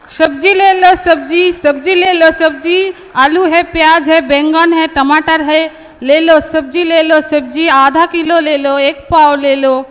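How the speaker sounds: melodic vegetable vendor call. Pretend. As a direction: call out your vegetables to passersby. Street vendor calling out vegetables.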